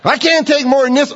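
shrieking high, laughing, get off; high playful shriek with laughter; high laughing shout. Retold or imitated. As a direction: squeal it out like a kid begging to stop. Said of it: "You're going".

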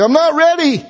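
high whining complaint; mock whimper, high; whiny protest. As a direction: whine it like a nervous child. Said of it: "You say".

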